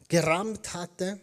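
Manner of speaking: ordinary talk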